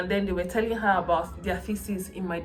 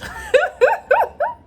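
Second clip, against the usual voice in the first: high burst of real laughter; loud giddy laugh; 8 dB louder than usual. Real, not acted. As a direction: crack up at yourself. Laughing at herself.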